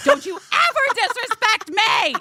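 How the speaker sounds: high, tough "don't you ever" threat; Roller Girl voice. Pretend. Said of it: "I'm like Roller Girl".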